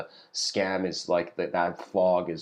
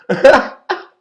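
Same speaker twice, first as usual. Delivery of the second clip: loud high laugh breaking out; high burst of laughter; 17 dB louder than usual. Real, not acted. Storyteller to viewers, cracking up at the hell punchline. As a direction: crack up at your own joke.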